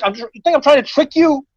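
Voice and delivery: can't believe what he's hearing; incredulous. Retold or imitated. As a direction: tenant baffled by the landlady's accusation. Incredulous retort to landlady.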